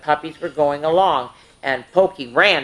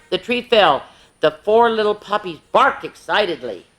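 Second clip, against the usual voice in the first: ordinary delivery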